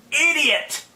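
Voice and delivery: a sharp, scornful jab; exasperated insult. Real, not acted. Ranting vlogger scolds doubters who won't see, exasperated. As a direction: spit out the insult in frustration.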